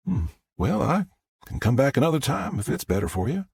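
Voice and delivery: a hint of a considerate Southern woman; lightly voiced Southern visitor. Visitor offers to come back another time, lightly Southern.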